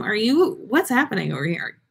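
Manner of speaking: usual speaking voice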